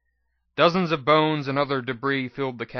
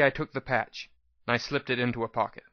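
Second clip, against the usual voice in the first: usual speaking voice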